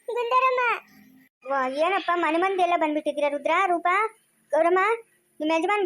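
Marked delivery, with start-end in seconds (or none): 0.1-0.6 high crying call; tearful wail. Acted. Weeping woman calls out while being comforted, tearful wail. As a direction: cry the name out, pitched high. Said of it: "ಅಳ್ಬೇಡ ಸುಮ್ನಿರಮ್ಮ".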